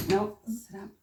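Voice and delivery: usual speaking voice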